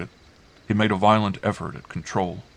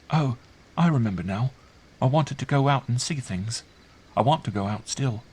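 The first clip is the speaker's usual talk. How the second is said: put-on higher-pitched child voice, a touch perplexed; bewildered child; 6 semitones higher than usual. A narrator's reading. Sleepy girl explaining herself to uncle, bewildered. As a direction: play a puzzled child just woken. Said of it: "Still looking bewildered and perplexed".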